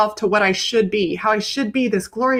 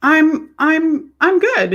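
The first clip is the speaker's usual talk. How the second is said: relieved after crying; 7 semitones higher, slower than usual. Retold or imitated. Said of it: "it was like".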